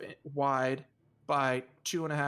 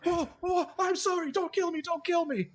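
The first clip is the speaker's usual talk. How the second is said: high, frightened begging; panicked pleading. Acted. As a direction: plead for your life in a high voice. Voices terrified man begging for life.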